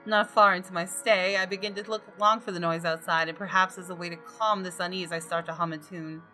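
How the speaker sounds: ordinary delivery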